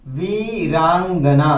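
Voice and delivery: वीरांगना drawn out very slowly for the class; slowly spelled out. Shown as a demonstration. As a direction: stretch out वीरांगना slowly, syllable by syllable. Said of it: "वीरांगना क्या है".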